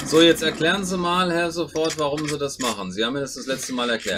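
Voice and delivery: lightly hammed host voice; mock interviewer. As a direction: play the show host. Host interviewing partner for video. (gespielter Moderator)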